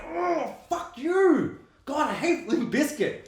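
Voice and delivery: high-pitched, exasperated outburst; hammed-up annoyed cry, voice pushed way up; mock outrage. Acting out annoyance at the film.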